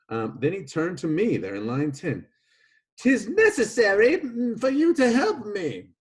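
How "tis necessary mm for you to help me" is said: high, loud put-on accent; Petro, changed accent. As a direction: play Petro in an exaggerated high-pitched accent. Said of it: "not really sure why Petro's, uh, accent changed there".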